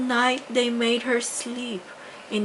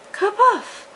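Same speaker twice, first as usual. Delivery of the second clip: high, loud burst of a magic pop; magic kaboof; 13 semitones higher, 6 dB louder than usual. A narrator's reading. Fairy turning the rat into a coachman, loud magical pop. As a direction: pop it out like a spell going off.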